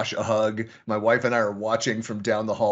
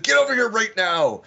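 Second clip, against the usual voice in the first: put-on a firm, raised order to a child, higher and louder; stern voice; 8 semitones higher, 5 dB louder than usual. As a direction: call the kid over like a strict dad, no arguing. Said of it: "Stern voice".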